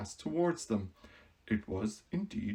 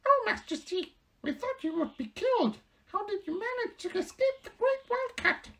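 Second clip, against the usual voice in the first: anxious mice squeaking. Acted. Mice relieved their queen survived, high squeaks.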